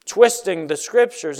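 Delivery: ordinary delivery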